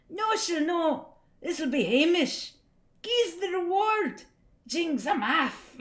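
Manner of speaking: high, hammed-up Scots accent. Streamer acting out Wally's hedgehog comic, full Scots accent. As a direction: go all in on a lilting comic Scots voice. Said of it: "You have to do the accent".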